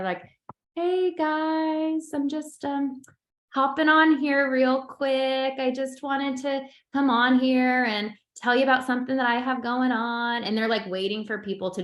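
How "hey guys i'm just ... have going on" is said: higher, slow breezy "hey guys" influencer voice; mock influencer intro. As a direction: ham up a rambling live-video opener. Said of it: "how many of us have seen the thing where".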